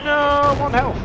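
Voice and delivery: high, loud cry of disbelief; shocked cry. Real, not acted. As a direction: yelp in frustrated surprise. Enemy survives on one HP.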